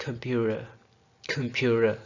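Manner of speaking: "कंप्यूटर" with ट as a light d or r tap; tapped r-like ट in "कंप्यूटर"; flap instead of T. Shown as a demonstration. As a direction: say "कंप्यूटर" as "kumpyura". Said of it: "T के बजाय D या रे की आवाज़".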